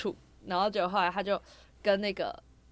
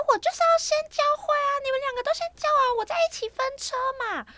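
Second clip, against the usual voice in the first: high, pinched mimicry of the coach; squeaky caricature of the driving coach; mock coach voice; 5 dB louder than usual. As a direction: ham up the coach's whiny insistence. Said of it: "他就开始讲很大声".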